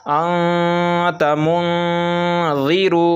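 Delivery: slow recitation, noon drawn out as a nasal hum; ikhfa on the noon. Shown as a demonstration. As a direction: hold the noon hidden in the nose, long and level. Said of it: "जैसे मैं आपको पढ़कर बता रहा हूँ".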